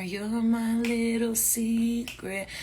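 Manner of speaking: slow, drawn-out teasing coo; secret whisper. Pretend. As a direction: savor every word slowly.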